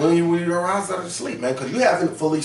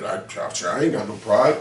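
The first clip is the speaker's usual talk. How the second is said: lower, put-on voice brushing off pride; mock prideful denial; 7 semitones lower than usual. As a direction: play a proud brother waving it off, lower. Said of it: "I'm like".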